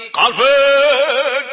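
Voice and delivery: long, loud, high wail; hammed-up grieving shout; crying scream. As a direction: stretch the name into a mourning howl. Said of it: "dia berteriak".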